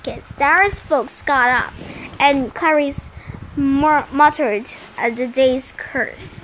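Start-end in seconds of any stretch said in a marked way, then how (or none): none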